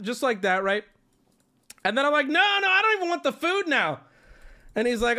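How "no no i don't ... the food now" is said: much higher, playful huff; mock indignant refusal. Customer refusing the food, mock offended. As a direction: refuse the food in fake outrage. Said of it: "I'm joking at this point".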